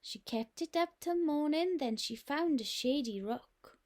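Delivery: ordinary delivery, nothing marked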